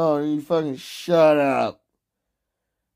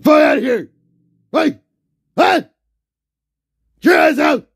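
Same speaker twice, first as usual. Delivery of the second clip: loud, higher shouts to get out; angry yelling; 8 semitones higher, 5 dB louder, faster than usual. Real, not acted. Streamer yelling someone out of his room, angry. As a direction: bark them out of the room.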